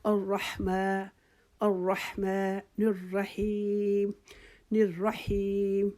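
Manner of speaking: slow, drawn-out Quranic recitation of الرحمن الرحیم; slow tajweed recitation. Shown as a demonstration. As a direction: recite الرحمن الرحیم slowly with the long vowels held. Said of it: "درمیانی رفتار سے".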